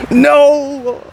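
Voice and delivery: high cracking "No!" shouted. Real, not acted. Groaning at his missed putt, voice cracking. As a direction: let the voice break on "No!". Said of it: "Voice crack, oh no".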